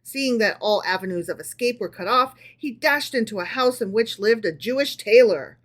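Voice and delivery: usual speaking voice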